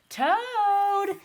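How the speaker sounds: high, loud calling. Acted. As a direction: call out across the forest. Bird calling for her friend.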